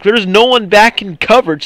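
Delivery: ordinary talk, nothing marked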